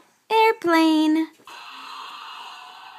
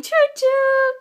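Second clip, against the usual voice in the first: high sing-song train whistle choo-choo. Shown as a demonstration. Mother making a high train sound for Gavin. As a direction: toot it high like a toy train. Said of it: "train sound for child".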